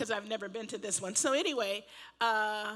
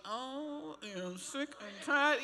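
mimicked weary husband; 2 semitones higher, much slower than usual. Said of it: "I know he was like".

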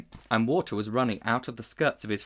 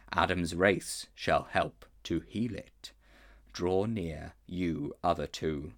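a solemn lion king speaking down; lion's voice; slower than usual. Acted. Aslan questions the boy before his counsellors, slow and grave.

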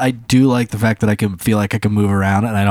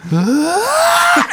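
mock pained yelp. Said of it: "And she's like".

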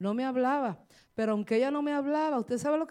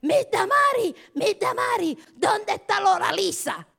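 high, loud frantic calling out; imitating a panicking coworker shouting for the teacher; panicked coworker yelling; 10 semitones higher, 8 dB louder than usual. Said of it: "Y ella empezó a gritar". (imitando a una compañera gritando asustada)

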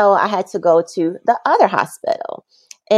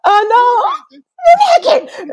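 mock shocked parent; 5 dB louder, slower than usual. Replaying parents' shocked line.

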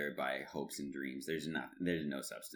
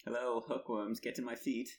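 higher-pitched lightly acted quote; playful higher quoting voice; quoting a cartoon; 6 semitones higher than usual. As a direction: toss it off as a mock cartoon line. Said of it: "That's from Archer".

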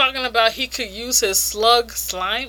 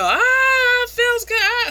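very high-pitched put-on squeal of pleasure; imitating screaming ecstasy; mock scream; 13 semitones higher, slower than usual. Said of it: "screaming her head off".

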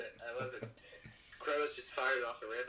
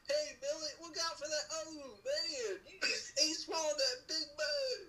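much higher, laughing pioneer voice; old settler; 14 semitones higher, slower than usual. Pretend. Joking about who named Big Bug Creek, high hammed-up settler voice. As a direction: ham up a frontier settler warning his buddy.

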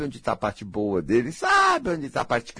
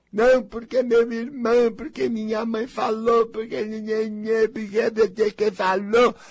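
high whiny mock complaint; mocking whiny excuse; 11 semitones higher than usual. To viewers, mocking their whiny excuses. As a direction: mimic the whiner with nhenhenhê.

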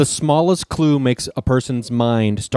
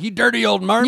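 mock grumpy insult; 10 semitones higher than usual. Quoting an old phrase, mock voice.

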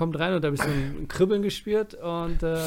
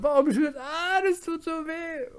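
gespieltes Schreien; 13 semitones higher, slower than usual. Acted. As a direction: wail it thinly in mock agony. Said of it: "die schreien so". (hoher, gedehnter gespielter Schmerzensschrei)

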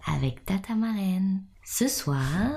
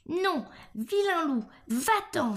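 frightened little pig's cry; 10 semitones higher than usual. A narrator's reading. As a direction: cry out high, like a scared piglet. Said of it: "s'écrièrent les deux frères, effrayés". (petit cochon effrayé, voix aiguë)

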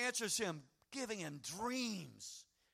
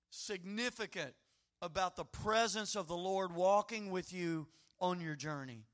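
normal talking voice

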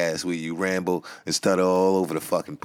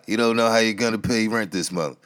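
usual speaking voice

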